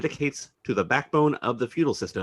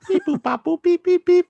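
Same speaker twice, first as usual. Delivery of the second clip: high beeping dial tones; phone dial beeps; 8 dB louder than usual. Pretend. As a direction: sing the keypad beeps high. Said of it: "dialing phone sounds".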